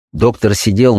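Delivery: usual speaking voice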